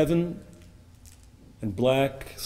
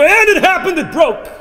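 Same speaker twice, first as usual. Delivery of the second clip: high, loud burst at the climax; dramatic outcry; 13 dB louder than usual. A narrator's reading. Storyteller to listeners as the ship breaks, dramatic outcry. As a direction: shout the moment of disaster.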